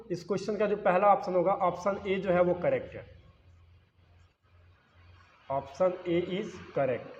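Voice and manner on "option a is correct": dictation pace. Shown as a demonstration. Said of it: "dictating the answer slowly".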